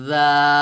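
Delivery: tongue tip lightly on upper teeth for a long, thin জাল; slow, drawn-out জাল, light dental sound; thin dhal demo. Shown as a demonstration. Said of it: "এভাবে".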